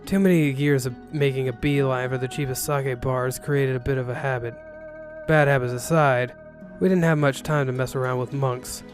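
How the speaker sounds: ordinary delivery, nothing marked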